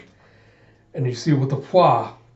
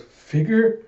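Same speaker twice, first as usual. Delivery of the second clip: much higher, stunned question; shocked gasp of a name; 8 semitones higher than usual. Acted. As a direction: say his name in disbelief. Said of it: "She goes".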